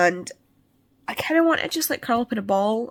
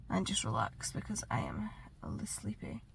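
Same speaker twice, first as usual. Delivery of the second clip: whispering; 15 dB quieter than usual. Real, not acted. Tired, sleepy, winding down.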